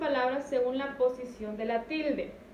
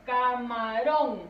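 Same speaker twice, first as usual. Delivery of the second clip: camarón slowly stretched, weight on the last syllable "rón"; stress on final syllable; 4 dB louder, much slower than usual. Shown as a demonstration. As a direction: draw out camarón, landing on "rón". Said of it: "Recae en ron". (camarón lento, con la fuerza en "rón")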